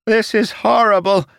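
high, loud horrified cry; screaming. A narrator's reading. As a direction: scream at the monster in horror. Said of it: "Silas screamed".

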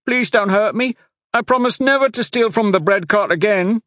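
put-on high, loud pleading child voice; a terrified little boy begging not to go in; boy crying in fear. A narrator's reading. Street boy begging the guards, high frightened plea. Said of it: "the boy cried".

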